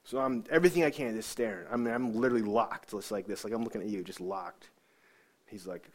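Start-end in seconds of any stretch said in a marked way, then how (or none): none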